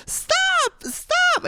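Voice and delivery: high shrieking stop; urgent shout. Retold or imitated. Friend shrieks at the attacker to stop the fight, high and urgent. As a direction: yell it to break up the fight. Pushing attacker away with backpack.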